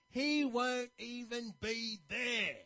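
grumpy barber slowly dismissing the Pope; scornful barber. Retold or imitated. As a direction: squash his hopes slowly. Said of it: "really negative sort of outlook".

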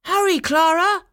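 high, loud, eager shout; calling out. A narrator's reading. Eager boy calling his sister to the gifts, calling out. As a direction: call out impatiently in a high voice. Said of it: "young Fritz Stahlbaum called".

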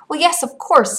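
high, bright gleeful retort. A narrator's reading. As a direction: crow it with teasing delight. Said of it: "said the boy gleefully".

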